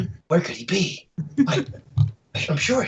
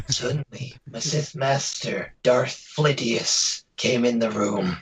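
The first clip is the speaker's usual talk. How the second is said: slow, low, hushed narration; noir detective; 3 semitones lower than usual. Acted. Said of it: "where's your noir voice".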